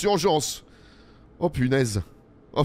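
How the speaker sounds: normal talking voice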